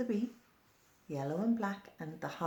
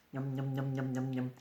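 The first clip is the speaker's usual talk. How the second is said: low munching yum yum sounds; lower yum yums; 4 semitones lower than usual. Acted. Caterpillar relishing leaves, low yum yums. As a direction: savour each yum like a tasty leaf. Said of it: "I munch on leaves".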